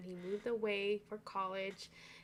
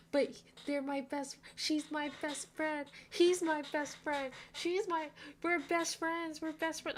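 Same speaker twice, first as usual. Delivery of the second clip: mocking whiny characters; 6 semitones higher than usual. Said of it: "they're like".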